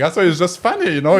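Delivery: ordinary talk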